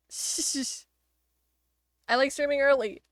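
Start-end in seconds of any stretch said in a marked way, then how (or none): 0.1-0.9 whisper. Real, not acted. Hushing Lyric while complimenting her.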